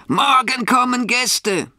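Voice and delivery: very high, drawn-out rooster cry; storybook rooster calling out; crowing rooster. A narrator's reading. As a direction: crow it out slowly, way up high. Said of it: "schreit aus Leibeskräften".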